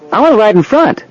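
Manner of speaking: put-on high-pitched insistent child; high little-kid voice; small boy. A narrator's reading. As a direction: pipe up with a little boy's demand. Small boy demands front seat.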